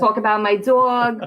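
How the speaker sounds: slow Long Island drawl on "dog"; Long Island accent. Shown as a demonstration. Guest shows off her home accent, Long Island accent. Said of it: "talk like this".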